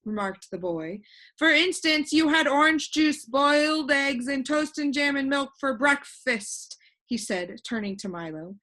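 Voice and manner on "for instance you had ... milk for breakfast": put-on higher, louder lively boy voice; a cheerful know-it-all boy floating in the air; boy explaining his family, bright and raised; voiced boy Alec. Alec lists Milo's breakfast.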